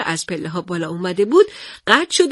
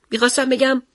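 plain reading voice

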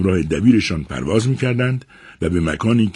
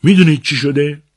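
raised, higher-pitched angry outburst; angry shout; 8 semitones higher, 8 dB louder than usual. Acted. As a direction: cry it out in a fury, loud and high. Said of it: "با خشم شدید ... فریاد زد".

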